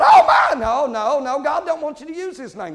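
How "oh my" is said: high, loud mock shriek of "Oh, my"; mock scream. Shopper seeing the Kmart blue light special, mock shriek. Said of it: "and you screamed out".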